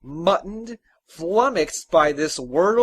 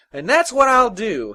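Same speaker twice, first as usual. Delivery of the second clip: higher, louder happy declaration; announcing; 7 semitones higher, 7 dB louder than usual. A narrator's reading. Happy son announces his plan to his father, brightly resolved. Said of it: "he announced".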